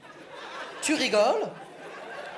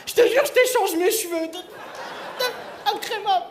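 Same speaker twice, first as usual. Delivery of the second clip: high-pitched, pleading beggar voice; mock begging; 9 semitones higher than usual. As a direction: beg for it in a raised voice. Acts out someone begging for a treat.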